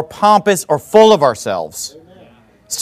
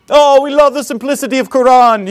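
mock believer's voice, pitched up; mocking put-on voice; 5 semitones higher than usual. Preacher mocking a Quran believer, in a put-on voice. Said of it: "don't call me racist for doing that voice".

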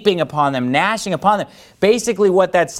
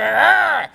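angry teeth growl; 10 semitones higher than usual. Shown as a demonstration. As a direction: growl through closed teeth. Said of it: "your teeth stay closed and you keep talking".

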